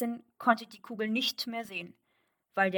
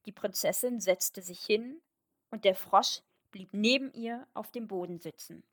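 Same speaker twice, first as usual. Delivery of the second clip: plain reading voice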